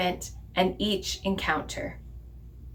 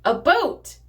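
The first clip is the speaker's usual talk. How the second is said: a restrained cry of discovery, voice jumping up; excited yell; 6 semitones higher than usual. Acted. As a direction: call it out in mild, acted excitement. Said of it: "in excitement and yell".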